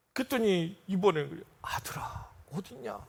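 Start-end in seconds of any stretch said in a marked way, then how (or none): 1.6-3.0 whispered, nearly voiceless call; faint dying whisper. Retold or imitated. Dying father calling for his son, whispered. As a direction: breathe it out like a dying man.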